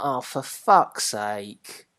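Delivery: slow, low and fed up; exasperated groan. Retold or imitated. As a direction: drag it out, fed up. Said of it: "I'm like".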